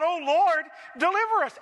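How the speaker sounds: high, pleading cry to the Lord; cry for help. Retold or imitated. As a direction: beg God to save them. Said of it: "They cried out".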